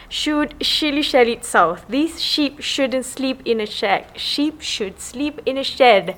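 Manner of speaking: slow careful reading. Real, not acted.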